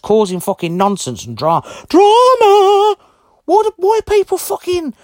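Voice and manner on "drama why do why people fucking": high, loud sing-song sneer on drama; scornful high-pitched mock whine; mocking drawn-out drama. Real, not acted.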